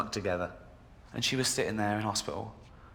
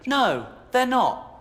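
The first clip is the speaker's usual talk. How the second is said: ranting; 8 semitones higher, 4 dB louder than usual. Real, not acted. Comedian rants at fat passengers, higher and louder. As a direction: hammer the point home. Angry rant at complainers.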